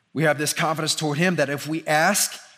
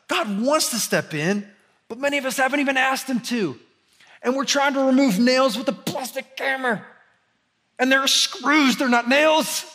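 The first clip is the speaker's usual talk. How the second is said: full-force preaching; 7 semitones higher than usual. Acted.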